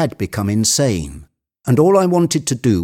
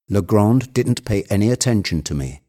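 ordinary delivery, nothing marked

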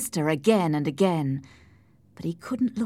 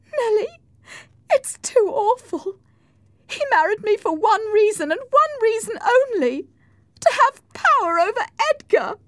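very high, loud lament; high-pitched, anguished complaint; distraught wife; 8 dB louder than usual. Acted. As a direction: pour it out in a high wail. Weeping wife pleads with Nelly.

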